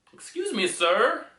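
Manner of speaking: lightly acted, high-pitched polite interjection; politely flag down an unruly customer, pitched high; hint of officious staff voice; mock staff member. Acts staff addressing an unruly passenger.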